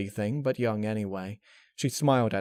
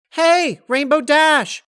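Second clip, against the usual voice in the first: high, loud cheerful call from afar; 12 dB louder, slower than usual. Acted. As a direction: shout her name across the field. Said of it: "a voice called".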